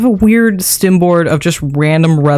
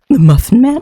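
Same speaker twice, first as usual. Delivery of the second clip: rising pitch; 6 semitones higher, 3 dB louder than usual. Shown as a demonstration. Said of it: "hits the right area".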